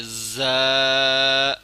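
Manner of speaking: زا said thin yet hard; thin but firm za. Shown as a demonstration. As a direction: keep زا light but firm. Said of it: "باریک ہے مگر سخت پڑھنا ہے".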